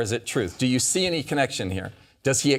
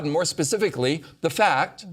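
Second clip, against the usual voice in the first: ordinary talk, nothing marked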